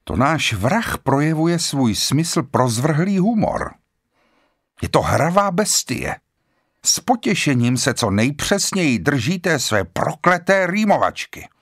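high, agitated and almost cutting; voice slightly cracking. A narrator's reading. Lombard rants about the killer's humour, high and strained. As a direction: let strain push the pitch up. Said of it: "trochu přeskakoval hlas, zněl skoro řezavě".

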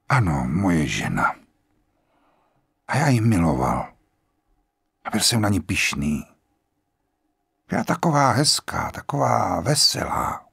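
lightly voiced grave general; old general, calm and grave. A narrator's reading. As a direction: speak like a resigned old man at peace.

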